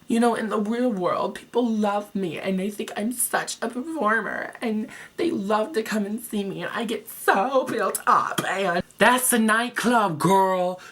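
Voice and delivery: high, pleading diva voice; Laganja in the reenactment. Acted. Contestant defending herself to the judges, high and pleading. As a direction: plead your case, pitched high. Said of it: "A dramatic reenactment".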